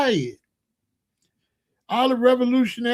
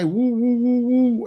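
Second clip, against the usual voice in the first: string of higher-pitched "woo"s; mock ranting noises; 4 semitones higher, slower than usual. Recalling his own meeting speeches, mimicked rant in woos. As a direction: imitate a rant with nonsense woos.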